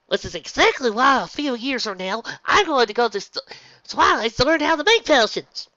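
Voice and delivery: high, loud eager filly; excited filly voice. Acted. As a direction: pitch it up with big plans.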